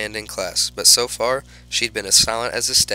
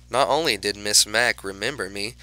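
plain reading voice

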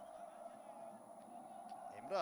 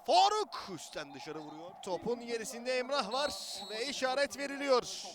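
ordinary delivery

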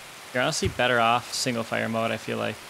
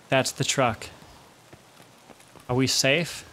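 ordinary talk, nothing marked

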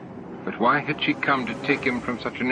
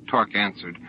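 plain reading voice

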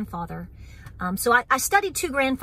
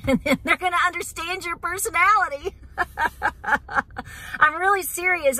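laughing; 7 semitones higher than usual. Real, not acted. Laughing about her Scots-Irish temper.

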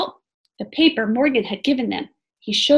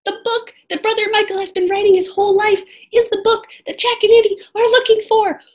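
excited reveal; 11 semitones higher, 4 dB louder, faster than usual. A narrator's reading. Big reveal in story.

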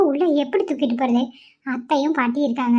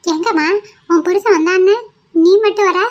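raised, higher mother-in-law voice; a nosy mother-in-law calling out, pitched up; 5 semitones higher, 6 dB louder than usual. Acted. Mother-in-law questions daughter-in-law. (உரத்த குரலில் மாமியார்)

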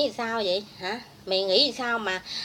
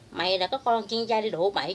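normal talking voice

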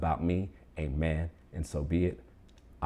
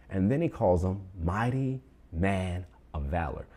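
ordinary talk, nothing marked

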